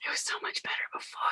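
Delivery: fast unvoiced whisper. Real, not acted. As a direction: rush it out breathlessly. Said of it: "A S M R".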